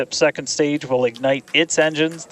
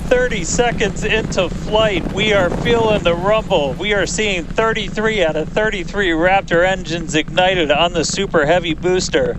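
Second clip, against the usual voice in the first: excited; 5 semitones higher than usual. Real, not acted. Launch commentary at liftoff.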